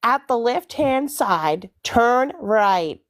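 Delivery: slow, deliberate American accent; sat-nav style American accent, drawn out; best American accent. Shown as a demonstration. Australian showing friends her best impression, American accent. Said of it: "My best American accent is".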